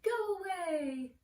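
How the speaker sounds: high, loud, drawn-out mock call; calling out go away. Teacher giving class an example of the word, mock call. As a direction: stretch it out like shooing someone off. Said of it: "you could say, like".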